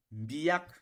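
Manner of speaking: "mbiak" pitched high and loud. Shown as a demonstration. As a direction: raise the voice on "mbiak". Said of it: "on fait une élévation dans la voix".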